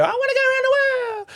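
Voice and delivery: very high wailing mimicry, quickening; hammed-up high, hurried cry; imitated ward patient. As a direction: screech it like a frantic fellow patient. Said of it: "come around my room and go".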